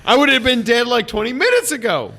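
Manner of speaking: amazed shout. Retold or imitated. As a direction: shout it in disbelief. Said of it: "I was like".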